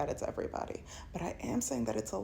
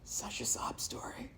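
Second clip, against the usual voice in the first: scheming man. Retold or imitated. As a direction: gloat about his plan, mocking. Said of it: "and go".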